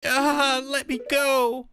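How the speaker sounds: high-pitched captive in indignant distress; alarmed captive. Acted. Captive character cries to Cody for help against witches, high alarmed voice. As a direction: act out a high-voiced character being dragged off.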